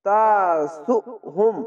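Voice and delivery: slow তাসুহুম with a push on the first syllable; stressed recitation. Shown as a demonstration. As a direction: lean into তাসুহুম, slowly. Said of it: "ঝোঁক মেরে পড়বেন". (ঝোঁক মেরে তাসুহুম)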